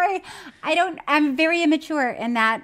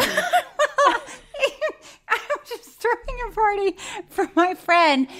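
higher, slow through laughter; laughing; 9 semitones higher, slower than usual. Real, not acted. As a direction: start the story laughing. Laughing starting story.

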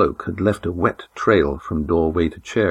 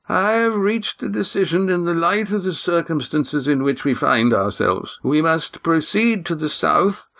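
put-on formal old man; 8 semitones higher than usual. A narrator's reading. Old Oron welcomes the Silver Swan, formal. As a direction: greet her like a stiff elder.

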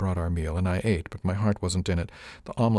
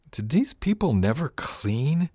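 Miss Arione muttering, higher and slow; a slow disgusted higher grumble; muttered; 8 semitones higher, slower than usual. Acted. Crew mate grumbles at grime on bulkhead, slow higher mutter. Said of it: "Miss Arione muttered".

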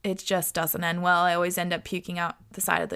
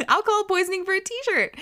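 laughing high disbelief; 10 semitones higher than usual. Real, not acted. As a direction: laugh it out incredulously.

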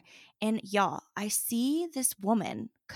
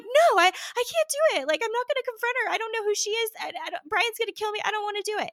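very high, rushed mock-whiny protest; panicked pleading; 12 semitones higher, faster than usual. Teenager refusing to confront the stranger, panicked pleading. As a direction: imitate a frightened teenager begging out of it.

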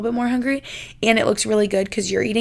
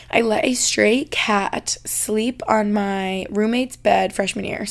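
read very slowly; much slower than usual. Real, not acted. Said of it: "I felt like I was reading that so slow".

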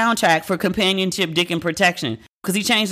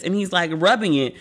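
ordinary talk

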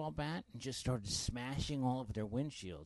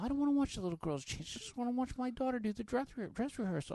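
high, rapid self-defence; indignant protest; 11 semitones higher, faster than usual. Retold or imitated. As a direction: protest fast like a wrongly accused dad. Retells protesting to accusing parents.

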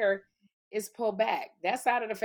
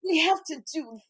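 put-on talking voice, higher and deliberate; Kim; 4 semitones higher, 4 dB louder than usual. Friend showing how Kim talks among rich people, mocking impression. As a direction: mimic your friend's affected voice, pitched up. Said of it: "Kim will start talking like this".

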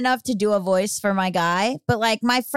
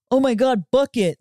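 made-up character voice; 3 dB louder than usual. Pretend. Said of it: "I'd make up a voice".

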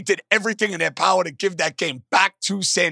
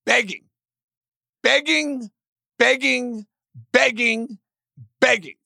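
each begging hit higher, falling; stress on begging; 6 semitones higher, slower than usual. Real, not acted. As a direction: lean hard on every begging. Emphatic repetition of begging.

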